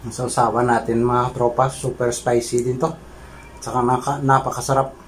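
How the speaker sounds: chewing between words; eating while speaking; mouth full. Real, not acted. Seen 0: food brought to mouth at -4; lips working while picking fish.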